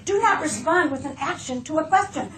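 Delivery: ordinary talk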